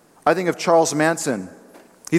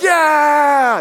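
high, loud drawn-out cheer; mock crowd cheer; 11 semitones higher, 6 dB louder than usual. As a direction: roar it like a worked-up rally crowd. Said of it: "everybody's like".

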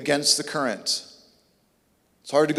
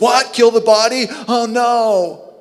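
high, loud panicked gasp; mock alarm; 8 semitones higher, 7 dB louder than usual. Preacher mocking people who fear death, high and panicky. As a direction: squeal it like someone terrified. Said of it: "Everybody on the other side's like".

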